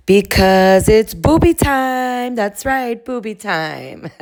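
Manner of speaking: high, drawn-out singsong announcement; put-on catchphrase voice. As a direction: stretch it out in a high, playful voice. Performing Stasely's catchphrase in high voice.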